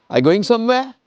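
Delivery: high questioning lift through to "somewhere"; rising question intonation. Shown as a demonstration. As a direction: pitch climbing high on "somewhere". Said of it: "sending your things up".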